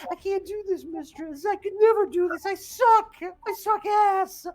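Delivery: high imp whine building to loud wail; despairing little demon. Acted. Demon confesses failure to his mistress, despairing high wail. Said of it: "he says".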